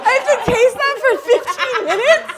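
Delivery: high, loud, laughing disbelief; laughing outrage. Real, not acted. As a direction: shriek it through laughter. Disgusted by the spray taste.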